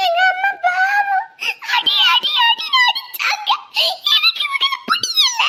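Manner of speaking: high, loud sobbing wail; crying shout. Acted. Child wailing to grandparents about the mother beaten, crying shout.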